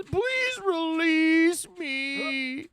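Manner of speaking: very high, slow, whiny begging voice; mock pleading Magnus. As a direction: beg for mercy like a sobbing captive, squeaky and high. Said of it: "until he cries and cries".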